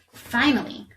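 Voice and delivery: ordinary delivery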